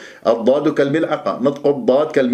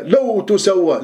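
لو تسوى lifted higher, as if overcome; overdone emotive reading; 5 semitones higher, slower than usual. Shown as a demonstration. Teacher parodies an over-involved reciter, higher and emotive. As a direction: exaggerate feeling with raised pitch on لو تسوى. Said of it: "متفاعل مع الآية".

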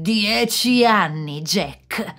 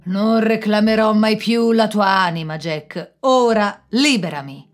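louder, grudging oath; defeated devil swearing; 3 semitones higher, 7 dB louder than usual. Acted. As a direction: spit out the promise through resentment. Il diavolo sconfitto giura. (giuramento forzato del diavolo, più forte)

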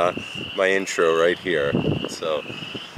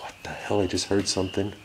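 startled hushed whisper; 7 dB quieter than usual. Real, not acted. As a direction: breathe it out, spooked. Alone in creepy funeral home.

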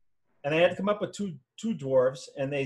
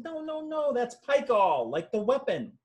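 innocent correction; 9 semitones higher, slower than usual. Retold or imitated. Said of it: "I said".

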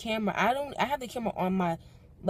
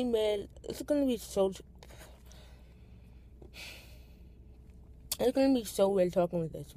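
talking with a lisp. Real, not acted. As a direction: lisp from retainers in mouth. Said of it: "I'm talking with a list because I have my retainers in".

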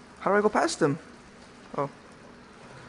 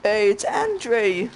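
slow drawn-out call; 6 semitones higher, 4 dB louder, much slower than usual. Real, not acted. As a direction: call his name slowly across the room. Calling out to character.

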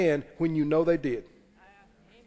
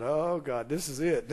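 mock dread; 6 semitones lower, 8 dB quieter than usual. Child's dread recalled.

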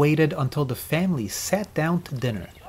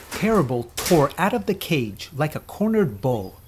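ordinary delivery, nothing marked

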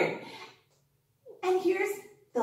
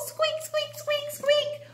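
high squeaky mouse voice; mouse squeaks; 13 semitones higher, slower than usual. Pretend. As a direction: play a little squeaking mouse for kids. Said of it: "it's Sleepy Mouse".

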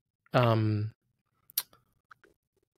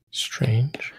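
whispering. Real, not acted. Therapist echoes client's word softly.

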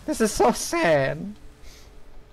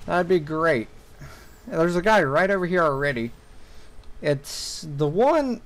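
ordinary talk, nothing marked

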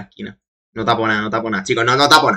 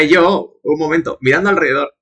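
ordinary talk, nothing marked

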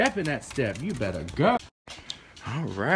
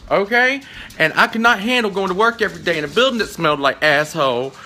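high, loud, emphatic venting; loud comic rant; 9 semitones higher, 11 dB louder than usual. Real, not acted. Owner complaining about dog breath, loud rant. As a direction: rant at full voice, punching the phrases.

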